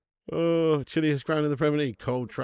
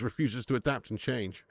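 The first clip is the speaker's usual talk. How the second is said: normal talking voice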